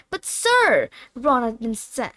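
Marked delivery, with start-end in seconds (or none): 0.1-0.9 protest. Acted. Ron protesting.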